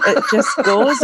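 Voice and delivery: bursting with a laugh, delighted. Real, not acted. Delighted over Scozzies nickname.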